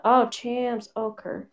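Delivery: usual speaking voice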